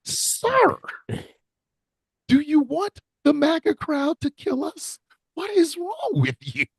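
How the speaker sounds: very high, slow, squeaky disbelief; appalled scolding. Retold or imitated. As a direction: plead in drawn-out mock horror. Acted scolding of the commentator.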